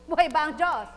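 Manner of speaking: very loud, high preacher's cry; rallying shout. Real, not acted. Preacher rousing the congregation with a loud cry. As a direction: shout it to the rafters.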